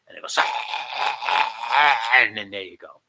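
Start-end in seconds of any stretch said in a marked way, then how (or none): 0.4-2.0 strangled, drawn-out rattle; imitated choking. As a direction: fake a choke in broken bursts. Imitates choking as weight drops.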